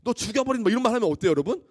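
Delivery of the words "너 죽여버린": mock fight threat. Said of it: "막 싸우다가 최고의 제일 안 좋은 말".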